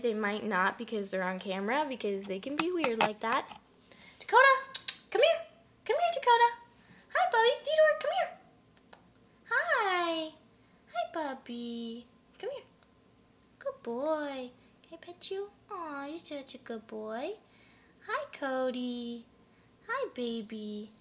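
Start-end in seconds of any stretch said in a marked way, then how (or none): 4.2-8.4 very high-pitched pet-calling voice; calling pets by name. Shown as a demonstration. Owner showing how she calls her guinea pigs, high calling. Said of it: "show you an example of me calling them by name". 9.5-20.9 soft, slow coo to a pet. Real, not acted. Owner petting her guinea pig, soft cooing praise. Cooing to pets that came over.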